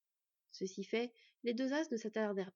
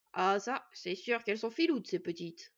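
amused approval; 4 dB louder than usual. A narrator's reading. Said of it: "approuva Nuri".

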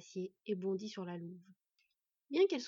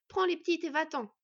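raised, louder urgent order; commanding; 7 semitones higher, 6 dB louder than usual. A narrator's reading. She-wolf orders the boy to flee, commanding. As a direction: send him away with authority. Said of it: "ordonna-t-elle au garçon".

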